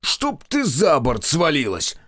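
slow, loud furious captain; angry curse. Acted. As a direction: curse her loudly and slowly.

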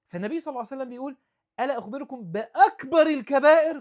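high and loud, the Prophet's question rung out; fast lead-in, then a loud high question; forceful quoted question. Real, not acted. Preacher proclaiming the Prophet's question, loud and high.

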